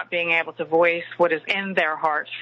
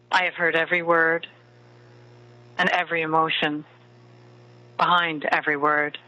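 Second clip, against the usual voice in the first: right in your ear. Acted. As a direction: murmur close like a lover at bedtime. Leads a bedtime love meditation.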